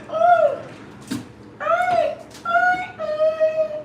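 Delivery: high, drawn-out "ooh" and "ay"; real squeals of delight over a scent. Real, not acted. Candle lover reacting to the smell, squealing in delight.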